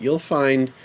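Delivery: normal talking voice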